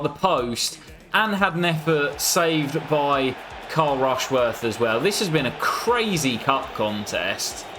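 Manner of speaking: ordinary delivery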